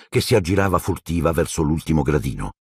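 plain reading voice

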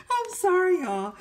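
high, loud, still cracking up; laughing apology. Real, not acted. Host to viewers after her joke, laughing apology. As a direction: apologize through the tail of a laugh.

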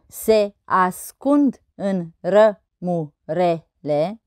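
very slow, each syllable separated. Shown as a demonstration. Teacher counting verse syllables on fingers, syllable by syllable. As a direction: count out the syllables one at a time. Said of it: "Să observăm numărând".

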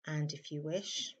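soft, unhurried meditation voice with long pauses; hushed, calm meditation guidance; guided meditation. Acted. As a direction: gently guide listeners into stillness, leaving space between phrases. Leads a meditation.

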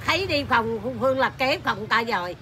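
ordinary talk, nothing marked

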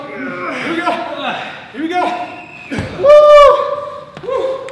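high cheering, building to a loud whoop; rally hard, then whoop; excited shout. Real, not acted. Cheering through the final seconds.

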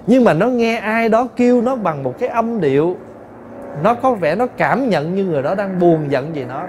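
usual speaking voice

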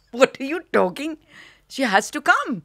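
high, loud disbelieving outburst; outraged protest. Retold or imitated. Retelling her outraged reply to the phone call, loud and high. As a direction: fire it back in shocked indignation.

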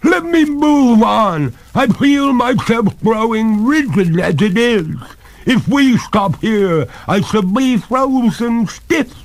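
high, loud ghostly plea; crying out. A narrator's reading. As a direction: beg frantically as you freeze up. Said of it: "cried the ghost".

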